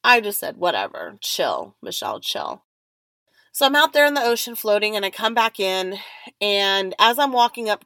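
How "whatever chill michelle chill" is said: low-pitched, offhand self-calming aside; dismissive self-talk. Retold or imitated. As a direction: drop low into a "whatever, chill" to yourself. Telling herself to chill.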